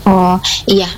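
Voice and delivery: ordinary talk, nothing marked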